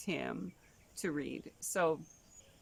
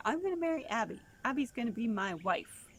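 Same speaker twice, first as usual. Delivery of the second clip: put-on lightly higher boy voice; little boy boast; 6 semitones higher than usual. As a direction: declare it like a sure little boy. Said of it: "he would always say".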